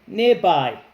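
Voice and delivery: "Nearby." said loud and clear for repeating; clear model word. Shown as a demonstration. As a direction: model "Nearby." loudly for the class. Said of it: "Everybody repeat after me".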